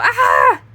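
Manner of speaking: sharp loud high yelp of pain; sudden pained yelp to startle the puppy; yelp as if hurt. Shown as a demonstration. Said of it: "like she hurt me".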